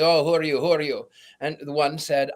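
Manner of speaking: ordinary talk, nothing marked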